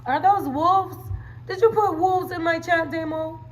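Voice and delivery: usual speaking voice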